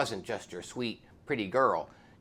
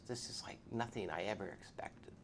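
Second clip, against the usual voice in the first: softer, hushed, overwhelmed; tearful whisper; 4 semitones lower, 10 dB quieter than usual. Retold or imitated. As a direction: whisper it, moved. Biker moved to tears.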